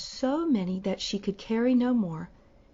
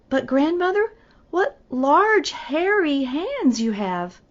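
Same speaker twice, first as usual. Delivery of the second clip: higher, louder and slow, growing alarm; girl staring at the wolf's hairy hands; 7 semitones higher, 6 dB louder, slower than usual. Acted. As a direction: stretch it out in startled wonder.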